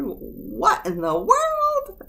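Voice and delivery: very high, very slow "what in the world"; mother hearing the kids yell; mother's exasperation. Retold or imitated. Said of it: "she would hear us yelling".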